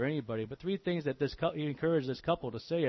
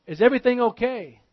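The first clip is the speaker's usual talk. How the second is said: dad calls out high and loud to the kitchen; worried father calling from the dining room; 9 semitones higher, 11 dB louder than usual. Retold or imitated. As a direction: shout the question into the next room.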